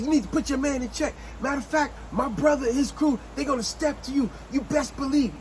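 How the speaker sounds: high, indignant threat; going off angrily. Acted. Girl threatening Nate with her brother's crew, going off angrily. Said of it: "now she goes not only off".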